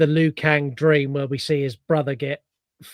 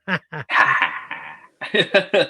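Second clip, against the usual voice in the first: a sly, staged ha ha ha rising at the end; knowing mock laugh; 3 dB louder than usual. Raiden winking at the audience, a knowing mock laugh. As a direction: laugh like you're winking at the camera. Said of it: "looks at the camera and goes".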